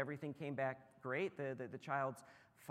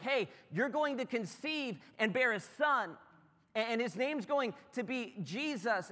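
higher, louder angel with big news; angel announcing; 8 semitones higher, 5 dB louder than usual. Retold or imitated. Angel greets Mary, bright announcing voice. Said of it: "the angel's like".

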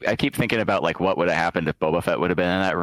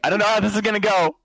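nervous; 11 semitones higher than usual. Acted. Nervous Boba Fett at elevator.

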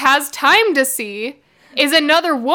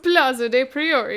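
bad Italian accent. Shown as a demonstration. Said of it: "here's my bad accent".

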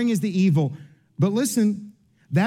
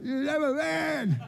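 slow, much higher, softer, puffed-up man voice; husband boasting to his wife, hammed-up macho voice; mock macho; 9 semitones higher, 7 dB quieter, much slower than usual. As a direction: ham up the proud he-man, slowly. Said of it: "I'm like".

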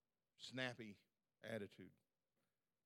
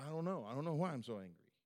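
loud, higher-pitched exasperated reply; exasperated retort; 5 semitones higher, 8 dB louder than usual. Retold or imitated. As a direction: act out a frustrated, defensive answer. Answers God while angry, retold.

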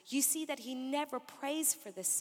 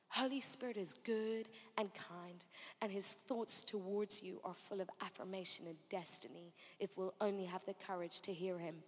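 soft, low, gentle preaching; tender reassurance; 4 semitones lower, 6 dB quieter than usual. Real, not acted. Preacher telling listeners the Spirit is kind, tenderly reassuring. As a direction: bring it down quietly to comfort.

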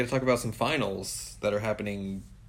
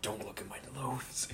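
hushed; 11 dB quieter than usual. Retold or imitated.

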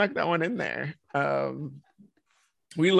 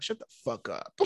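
soft, offhand telling-off; normal-toned mean remark; 2 semitones lower, 9 dB quieter than usual. Retold or imitated. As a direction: say the mean thing quietly, without anger. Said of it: "like, a normal tone".

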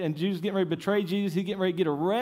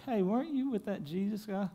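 lightly voiced little girl, higher and softer; 5 semitones higher, 6 dB quieter than usual. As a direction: put on a hint of a curious girl. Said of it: "a little girl at a campfire".

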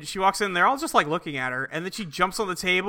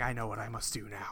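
much softer and lower, dark and grave; mock ominous resolve; 8 semitones lower, 16 dB quieter than usual. Retelling the heroine after the cat meows, ominous hush. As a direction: make it a hammed-up solemn vow.

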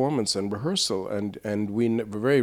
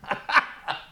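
high breathy burst of laughter; a quick, airy laugh at himself; real soft laugh; 3 dB quieter than usual. Real, not acted. Laughing at the critic's remark.

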